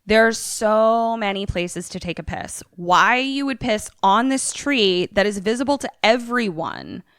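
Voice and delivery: ordinary talk, nothing marked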